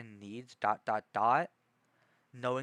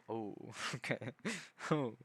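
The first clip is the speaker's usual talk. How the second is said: ordinary talk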